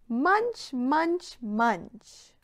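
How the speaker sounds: loud, high playful munch munch munch; munch munch, falling away; munching sounds. Pretend. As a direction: chomp it out like a hungry horse. Horse eating sound.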